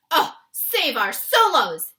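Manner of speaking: loud slow wail; loud anguished giant's wail; anguished cry. A narrator's reading. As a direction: cry out in despair. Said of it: "he cried".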